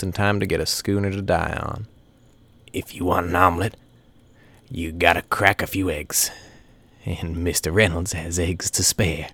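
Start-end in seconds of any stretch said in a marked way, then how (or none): 2.7-9.3 the rat. A narrator's reading. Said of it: "the rat had a fondness of saying".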